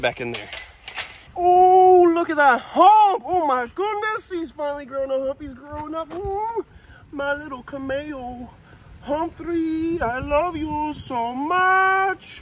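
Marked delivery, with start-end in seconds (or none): 1.3-8.5 delighted gushing. Real, not acted. Admiring the camel's hump. 9.0-12.4 high, very slow and doting. Real, not acted. Telling the camel he loves him, in slow high baby talk.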